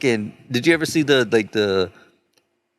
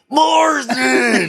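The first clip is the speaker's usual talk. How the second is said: high, drawn-out mock scream; hammed-up high holler; imitated scream; 13 semitones higher, 4 dB louder than usual. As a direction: scream the name like a raving wild man. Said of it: "he just starts screaming".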